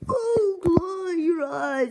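high tearful cry. Acted. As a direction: wail the name. Reunion, crying out.

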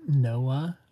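usual speaking voice

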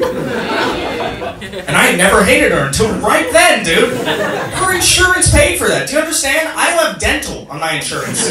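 high-pitched comic rant; worked-up, incredulous complaint, pitch climbing; mock outrage. Comedian fuming over friend's insurance paying for surgery, mock outraged. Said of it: "I was mad".

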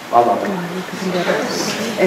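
dismissive. Real, not acted. Skipping the description.

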